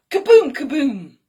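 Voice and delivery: sound effect. Acted. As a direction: bang it out like a pancake landing.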